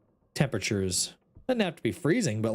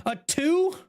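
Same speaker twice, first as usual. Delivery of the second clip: incredulous; 14 semitones higher than usual. Real, not acted. Disbelief at low score.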